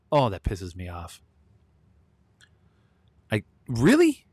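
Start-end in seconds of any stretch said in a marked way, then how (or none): 3.3-4.3 high, loud "really?" in disbelief; can't believe what he just read; incredulous. Real, not acted. Outraged at the verdict.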